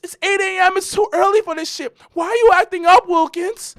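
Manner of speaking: high mock girlfriend whine. Imitating his girlfriend complaining at eight AM, high voice. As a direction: mimic her outraged, pitched high. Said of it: "She's like".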